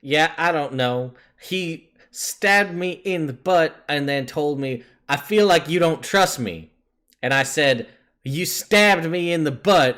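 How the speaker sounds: acted exasperated retelling, punching each phrase; voicing an aggrieved rider. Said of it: "she's just like".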